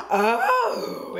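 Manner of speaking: sharp high gasp of delight; gasp, then a high excited oh; surprised gasp. Real, not acted. Palette is raised.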